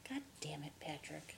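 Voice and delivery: whispered mock exasperation; hushed, low comic curse; mock scolding whisper. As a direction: scold him under your breath. Mock scolding of absent Patrick.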